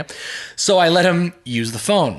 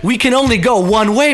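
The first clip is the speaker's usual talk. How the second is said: mock yell; 9 semitones higher, 6 dB louder than usual. Imagined retort to drive-thru honker.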